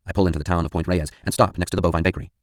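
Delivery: plain reading voice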